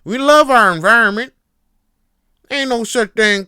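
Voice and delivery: high, very loud Southern hillbilly holler; Southern accent. Acted. Hillbilly denying climate change, hollered in a Southern accent. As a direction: shout it as a hammed-up Southern buffoon. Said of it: "I'm still gonna go with it".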